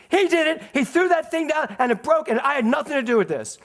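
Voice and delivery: put-on high, loud tattling kid voice; brother blaming loudly. Brother shouting the blame to father, high and loud. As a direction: shout the blame like a panicked boy. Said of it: "Tommy jumped off the stairs".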